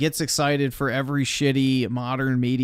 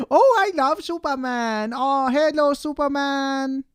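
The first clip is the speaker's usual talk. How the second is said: much higher sing-song imitation of the server; mock sweet server; 10 semitones higher than usual. Mocking the server who greeted his shirt, high and cooing. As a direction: coo at him like at a small child.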